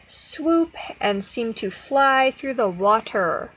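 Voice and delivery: slow storybook read, R sounds modeled; reading aloud to a child. Shown as a demonstration. Therapist reading an ocean book to a child, slow storybook voice. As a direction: read it slowly like a picture book. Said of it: "modeling the correct R sound".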